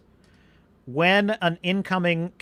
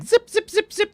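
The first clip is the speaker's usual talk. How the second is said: high squeaky zip noises; zipping sound effect. Retold or imitated. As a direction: chirp each zip up high. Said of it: "how they just, like".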